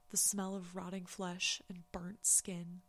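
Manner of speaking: slow, weighted horror line; slow dramatic. Acted. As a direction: linger on each word, ominous.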